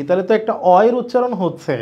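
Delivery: ordinary talk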